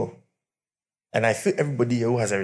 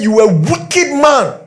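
loud, higher, slow furious rebuke; angry shout; 11 semitones higher, 9 dB louder than usual. Retold or imitated. Friend yelling at the foolish husband, furious. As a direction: yell it like a scolding friend. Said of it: "He said".

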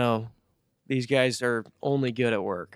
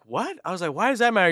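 high-pitched, incredulous mock query; retold incredulity; 7 semitones higher, 3 dB louder than usual. Man retelling his reply to a coworker's question, incredulous. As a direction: throw it back high, as if baffled.

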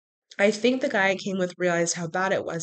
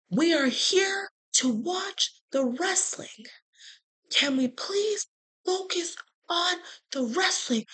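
higher, tense whispered rebuke; hushed scolding; 9 semitones higher, slower than usual. Retold or imitated. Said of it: "could not believe that this man just yelled at".

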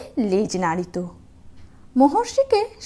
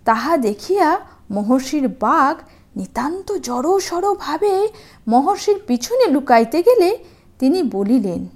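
ordinary delivery, nothing marked

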